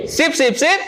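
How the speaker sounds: high triple call; three quick high chirps for the class to answer; seruan bernada. Shown as a demonstration. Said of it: "ada nadanya, ada intonasinya". (seruan tinggi tiga kali beruntun)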